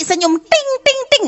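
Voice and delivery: high tinkling ting ting ting; a light sing-song ting; sweet mock ting. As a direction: chime it like a sweet smile. Mimics a wife's smile sound.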